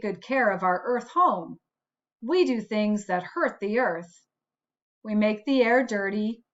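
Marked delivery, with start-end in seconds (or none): none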